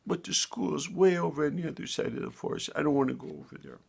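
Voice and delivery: high whiny chick voice; protesting little bird. A narrator's reading. Little bird pleading with mother against school, whiny. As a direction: whine it like a reluctant child.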